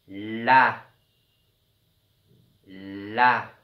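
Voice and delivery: "La" with a long held l; drawn-out sounds. Shown as a demonstration. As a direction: linger on the l, then open loudly into the a of "La". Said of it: "il faut bien écouter le son des lettres".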